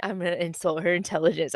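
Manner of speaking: normal talking voice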